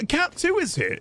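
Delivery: very high, failed impersonation; August. Acted. Streamer replaying a failed impersonation, high mock voice. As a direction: squeak out a bad mock impression. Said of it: "Is that how I sounded when failing to impersonate August?".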